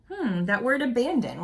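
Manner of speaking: ordinary delivery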